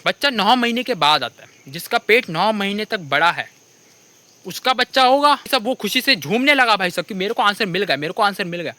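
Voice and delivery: ordinary talk, nothing marked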